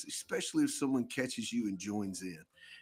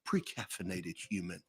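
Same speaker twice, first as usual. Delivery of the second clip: nature documentary; 4 semitones lower, 6 dB quieter than usual. Shown as a demonstration. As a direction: narrate like a wildlife film, hushed. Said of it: "narrating your commute to work like a nature document".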